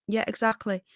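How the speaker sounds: ordinary talk, nothing marked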